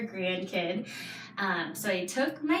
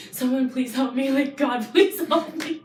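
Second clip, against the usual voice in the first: louder, rising imitated cry for help; plead, building higher and louder, half in jest; mock desperate plea; 4 semitones higher, 7 dB louder than usual. Mom silently begging God for help on the plane, mock desperate. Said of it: "I kept thinking in my head".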